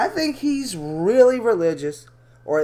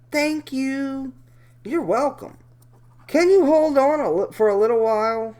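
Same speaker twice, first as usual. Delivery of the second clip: voiced chat log; 7 semitones higher than usual. Voicing girl/predator chat.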